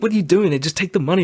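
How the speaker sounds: high pleading whine; baffled plea. Retold or imitated. Brother pleading with the robber to take the money. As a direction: beg him to just take it. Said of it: "He's like".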